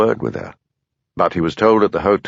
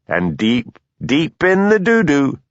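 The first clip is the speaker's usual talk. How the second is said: slow, high sing-song gloat on doo-doo; 6 semitones higher, slower than usual. A narrator's reading. Blair taunting Hamish about his trouble, slow high gloat. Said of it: "he crowed".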